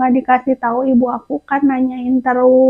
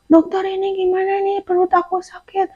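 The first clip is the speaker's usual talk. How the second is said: higher, softer plea; soft whimpering plea; 6 semitones higher, 6 dB quieter than usual. Retold or imitated. Patient complaining to the doctor about stomach pain, soft whimper.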